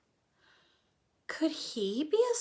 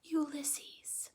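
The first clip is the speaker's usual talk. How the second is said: soft breathy whisper of the name; 12 dB quieter than usual. Acted. Flora naming the squirrel in awe, soft whisper. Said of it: "whispered Flora".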